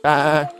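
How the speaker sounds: loud, high bleat like a goat. Shown as a demonstration. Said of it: "Goats bleat to say hello".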